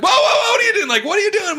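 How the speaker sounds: high, loud alarmed shout; shouting whoa, high and loud; teacher yelling. Retold or imitated. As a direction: stop him at the bus door.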